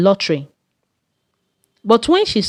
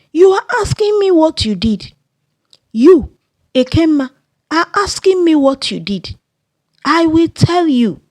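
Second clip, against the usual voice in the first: raised angry voice; 8 semitones higher than usual. Acted. Mother yells at Ekema before the crowd, outraged. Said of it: "Her mother increased her voice".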